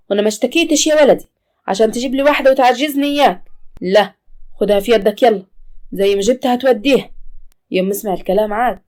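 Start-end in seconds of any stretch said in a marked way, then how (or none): 0.1-7.1 higher, louder scolding mother; loud stubborn mother's refusal; indignant old mother. Acted. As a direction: throw the maid out with a mother's anger.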